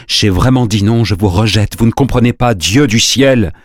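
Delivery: forceful, desperate rejection; desperate cry. A narrator's reading. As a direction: cry out in complete despair. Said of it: "elle s'écria complètement désespérée".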